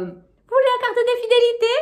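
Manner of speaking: high, bright-eyed shop cashier. Retold or imitated. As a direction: play the chirpy cashier up high. Cashier voiced in retold story. (voix de caissière aiguë)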